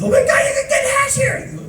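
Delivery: very high mock squeal; American tourist kids. American tourist kid discovering hash in Amsterdam, mock squeal. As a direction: shriek it like an overexcited kid. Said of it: "American tourist kids".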